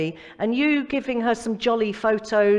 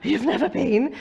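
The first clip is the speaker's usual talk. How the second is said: higher-pitched incredulous question, with a laugh; breathless disbelief; 4 semitones higher than usual. Retold or imitated. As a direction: imitate being gobsmacked by a friend's answer. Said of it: "Couldn't even catch my breath".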